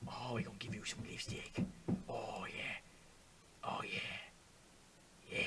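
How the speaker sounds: low, hushed sultry purr; whisper-croon it with hammed-up seduction; mock sultry. Player applying lipstick to character, mock sultry.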